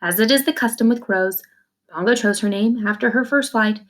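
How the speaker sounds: plain reading voice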